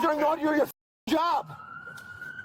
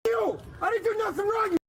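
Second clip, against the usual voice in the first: furious shouted protest, higher; angry shouting; 7 semitones higher than usual. Real, not acted. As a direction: yell it in outrage. Man resisting arrest, yelling.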